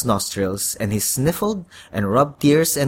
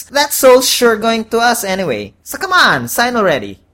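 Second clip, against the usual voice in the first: higher, louder salesman devil; pushy horned devil; 7 semitones higher, 5 dB louder than usual. A narrator's reading. Devil pressures the kid to sell his soul, impatient and pushy. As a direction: press hard for the signature.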